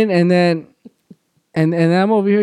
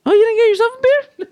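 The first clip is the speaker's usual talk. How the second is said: high mock girly voice; a high mock girlfriend voice asking about his beer; mimicking girlfriend; 4 dB louder than usual. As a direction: mimic her sweet surprise. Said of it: "she's like".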